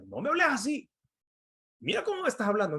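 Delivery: put-on husband recalling his old irritated comeback; retold angry reply. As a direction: snap back the way he used to.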